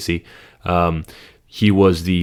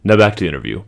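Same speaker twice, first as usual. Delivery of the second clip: usual speaking voice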